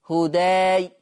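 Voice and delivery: هداي said with the alif wide open; with fatḥ. Shown as a demonstration. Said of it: "كده فتح".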